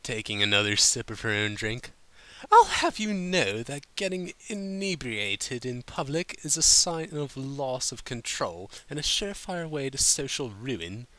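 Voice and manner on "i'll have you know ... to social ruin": high, refined lecturing voice; prim Rarity. A narrator's reading. Rarity lecturing Applejack on public drinking, high prim voice. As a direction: lecture like a proper lady. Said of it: "Rarity sniffed".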